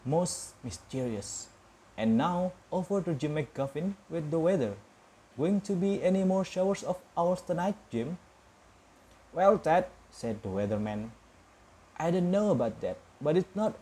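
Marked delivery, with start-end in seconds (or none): 0.0-8.2 crisp newsreader delivery, a bit higher and brighter in energy; news bulletin. Acted. Newscaster hands over to weatherman. 9.3-9.9 louder, higher, breezy weatherman voice; chirpy forecaster taking the handover; cheery TV weatherman. Acted. Weatherman answers the newscaster.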